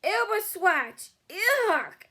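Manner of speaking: loud high Ew of disgust; disgusted roar. Acted. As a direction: roar out in revulsion. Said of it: "roared the blood bottler".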